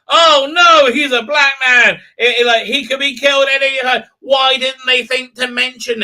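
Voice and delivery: high, loud sarcastic panic; mock alarm. Reviewer sneering at the show, loud mock alarm. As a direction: fake a gasp of horror. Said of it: "sarcastic mock alarm".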